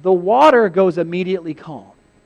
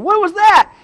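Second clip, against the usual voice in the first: high, loud alarmed question; spooked, demanding to know; hammed-up panic; frightened exclamation; 13 semitones higher, 7 dB louder than usual. Apostles panicking in the graveyard.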